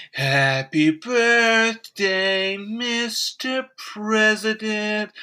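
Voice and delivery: slow breathy starlet singsong; Marilyn Monroe. Retold or imitated. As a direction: coo it slowly like Marilyn. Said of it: "She goes".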